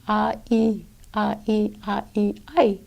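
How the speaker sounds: "ah, e" drawn apart slowly, then glided into "I"; slow diphthong breakdown. Shown as a demonstration. Teacher breaking down the I diphthong for learners, slow and deliberate. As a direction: spell out the I diphthong sound by sound, slow and soft. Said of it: "pronounce an I as a diphthong".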